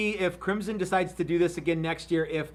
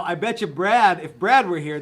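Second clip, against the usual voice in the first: usual speaking voice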